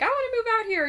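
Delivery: high, gushing voice of a wannabe New Yorker; mock eager friend. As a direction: gush it like a starry-eyed friend. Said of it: "friends who are always like".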